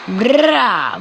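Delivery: long rolled "brrra"; bruitage d'attaque. Pretend. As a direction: trill the R like a rumbling charge. Imite le bruit de l'attaque. (bruitage de charge, « brrra » roulé)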